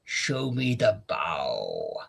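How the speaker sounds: slow, low mock dad voice; lightly voiced weary father; imitating the father. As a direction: draw it out slowly, low. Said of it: "And he's like".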